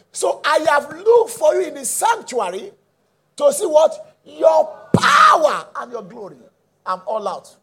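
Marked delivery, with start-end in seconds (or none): 0.1-6.4 high, loud, slow scripture proclamation; impassioned preacher crying out the verse; fervent preaching. Real, not acted. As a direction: build to a long, shouted "power". Preaches the verse at full force.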